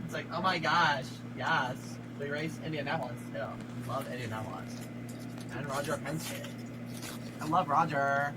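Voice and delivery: lightly camp mock voice of a driver, gushing; a hint of a camp racing driver.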